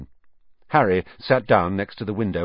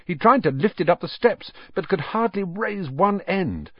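ordinary delivery

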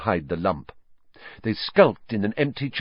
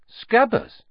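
blank. Acted. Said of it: "said Ron blankly".

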